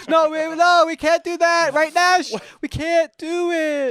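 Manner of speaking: high, loud hammed-up refusal; mock protest. Friends refusing to leave the wounded man, mock-anguished shouting. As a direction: wail it in fake heroic protest.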